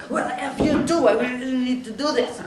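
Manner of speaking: loud, high-pitched comeback; exasperated retort. Retold or imitated. Psychiatrist answering patient's "F you", loud exasperated retort. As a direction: fire it right back at him, loudly.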